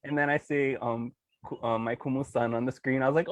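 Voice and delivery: normal talking voice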